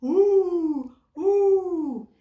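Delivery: very loud, high howl like a dog; howling call. Acted. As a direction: howl out to the flock. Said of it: "like a dog howling".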